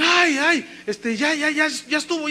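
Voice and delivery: high-pitched excited voices calling out; urgent outcry, imitated. Hospital voices overheard during the prayer, imitated excited outcry. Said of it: "cuando oye voces que dicen".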